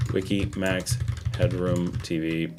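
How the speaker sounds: robot voice. Acted. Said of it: "Did I sound like a robot?".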